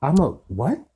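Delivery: high, loud gasped question; boy stunned by the news. Acted. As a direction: gasp it in shock. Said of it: "gasped Harry".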